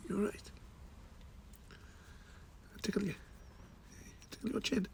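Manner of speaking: high, soft playful coo. Real, not acted. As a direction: tease the cat gently. Baby talk to cat.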